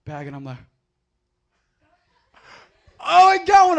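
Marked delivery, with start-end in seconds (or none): 3.0-3.8 high, loud thrilled exclaim; excited discovery. Retold or imitated. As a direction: burst out with delight. Teen finds BB gun.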